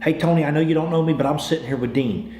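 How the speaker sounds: ordinary delivery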